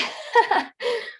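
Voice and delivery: a sudden high laugh through tears; real laugh breaking high through tears; high tearful laugh. Real, not acted. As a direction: laugh out the release, high and bright.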